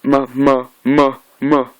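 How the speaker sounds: halting syllables, each pushed out separately; stuttering. Retold or imitated. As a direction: imitate a struggling, broken stutter. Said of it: "he's stuttering, he's trying to say".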